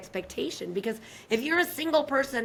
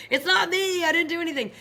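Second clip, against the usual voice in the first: high, loud mock denial; defensive protest; 8 semitones higher, 4 dB louder than usual. Someone defending themselves against a partner, hammed-up and indignant. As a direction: plead innocence in an exaggerated high voice. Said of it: "you wanna defend yourself".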